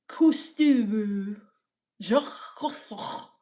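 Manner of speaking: mock-French Cousteau; hammed-up French pronunciation; exaggerated French accent. Shown as a demonstration. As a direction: overdo the French accent on the name. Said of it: "Close, he's French".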